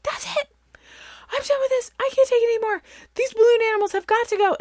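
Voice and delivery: high, frantic fed-up outburst; retold snap, shrill and fast at the end; snapping mom. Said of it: "something in me, like, snapped".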